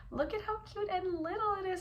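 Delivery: put-on high cooing baby talk; cutesy baby voice. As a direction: coo at it like a baby. Cooing over a tiny product.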